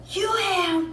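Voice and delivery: high, barked drill-instructor order; yelling. Retold or imitated. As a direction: bark it at the recruits. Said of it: "yelling, yelling, yelling".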